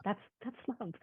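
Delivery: very softly, moved by the memory; hushed, moved. Real, not acted. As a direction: let it out as a soft murmur. Tearful memory of noticing small kindness.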